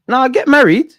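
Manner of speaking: high, loud take-back; quick reversal. Real, not acted. Reversing his advice to the viewers, high and loud. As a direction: jump to undo the advice.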